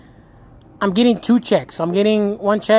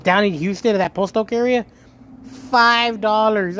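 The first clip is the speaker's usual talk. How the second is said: ordinary talk, nothing marked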